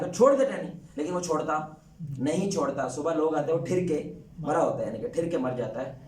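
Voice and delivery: ordinary talk, nothing marked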